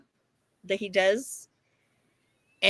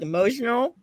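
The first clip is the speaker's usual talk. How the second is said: usual speaking voice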